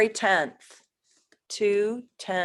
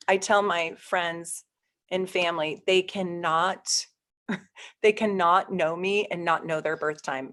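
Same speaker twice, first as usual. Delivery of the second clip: normal talking voice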